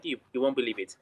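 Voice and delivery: usual speaking voice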